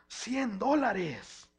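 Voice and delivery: drawn-out gloat. Retold or imitated. Child gloating over a birthday check.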